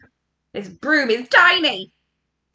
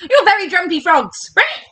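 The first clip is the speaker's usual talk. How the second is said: usual speaking voice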